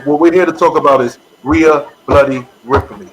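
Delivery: ordinary delivery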